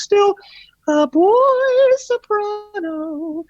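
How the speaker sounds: he mimics his childhood soprano, high and drawn out; boy soprano voice. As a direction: float the words up high like a young choirboy. Recalls pre-breaking voice.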